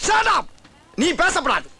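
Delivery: high-pitched yelp of command; sharp rebuke. Acted. Constable silencing prisoner who picks his escort, high shout. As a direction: cut him off in a high shout.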